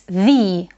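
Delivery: the said slowly as thee, with a long E vowel; strong form thee. Shown as a demonstration. Teacher demonstrating the stressed form, slow long thee. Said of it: "its pronunciation is strong".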